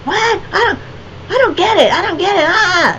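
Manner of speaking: baffled customer. Retold or imitated. Confused customer fleeing the artist's price hike, mock whimper. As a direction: play him as a flustered guy who can't follow.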